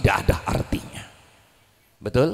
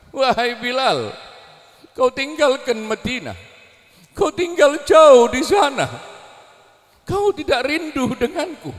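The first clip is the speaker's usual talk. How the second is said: higher voice of the Prophet reproaching Bilal; lightly voiced sorrowful reproach, higher; gentle rebuke; 7 semitones higher, 4 dB louder than usual. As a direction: reproach him with longing, rising to a cry on gersang.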